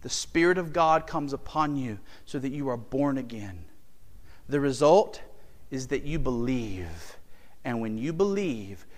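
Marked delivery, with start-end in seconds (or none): none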